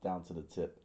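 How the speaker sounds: ordinary delivery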